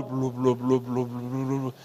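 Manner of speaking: low, soft mumbled blu-blu chatter noise; imitated crowd babble. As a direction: mimic the distant hubbub of a noisy crowd. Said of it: "vão ouvindo barulho".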